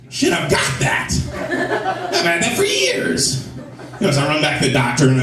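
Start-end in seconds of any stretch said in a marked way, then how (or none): 0.1-3.4 hushed then rising to a high, slow wail of panic; mock paranoid breathy wail; panicked realisation. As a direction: freak out slowly as it dawns. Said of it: "I'm like".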